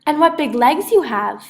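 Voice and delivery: put-on high, loud young girl voice; a bright little girl questioning her grandma; little girl Cindy, puzzled. A narrator's reading. Cindy telling the wolf-grandma she looks different, high and loud.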